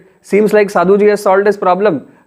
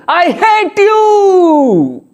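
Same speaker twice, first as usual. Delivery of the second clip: long, high, drawn-out furious yell; angry shout; 11 semitones higher, 3 dB louder, much slower than usual. Acted. Unloved man shouting his anger at the valley, drawn-out yell. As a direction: bellow it across the valley in rage. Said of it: "he shouted on the top of the lung".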